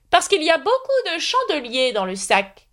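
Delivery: put-on high, fussy old knight voice; high knightly voice promising to escort Alice. A narrator's reading. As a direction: play the kindly, dotty knight up high.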